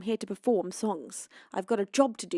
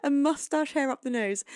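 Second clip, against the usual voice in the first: excited; 7 semitones higher, 6 dB louder than usual. Real, not acted. Reacting to funny comment.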